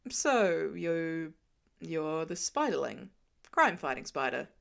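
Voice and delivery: a hint of a wry grown man; lightly voiced Tony. A narrator's reading.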